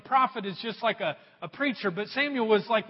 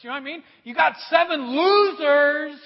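ordinary talk

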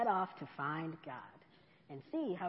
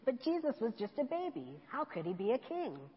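put-on lightly raised child voice; little cub voice; 5 semitones higher than usual. Cub questioning Mama, lightly higher child voice. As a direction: ask it as a puzzled little cub.

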